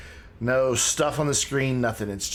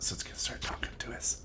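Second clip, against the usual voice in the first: whisper; 11 dB quieter, faster than usual. Real, not acted. Hushing before the broadcast plays.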